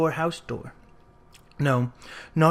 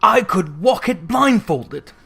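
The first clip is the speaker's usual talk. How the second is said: fervent; 3 semitones higher, 5 dB louder, slower than usual. A narrator's reading. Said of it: "cried Scrooge with fervour".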